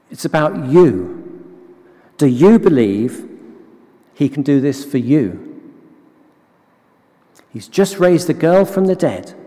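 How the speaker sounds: ordinary talk, nothing marked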